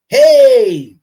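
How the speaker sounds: a big, loud hammed-up call of "hey"; mock shout. Host mocking Eddie hollering at the woman, loud mock call. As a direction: call out across the street, mocking.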